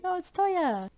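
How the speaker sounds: very high happy exclaim; light up seeing Toya; delighted surprise. Retold or imitated. Saki delighted.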